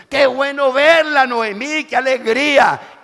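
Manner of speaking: loud, high, slowed joyful welcome; women greeting. Acted. Village women greeting returning Noemí, loud joyful welcome. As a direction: sing out your welcome.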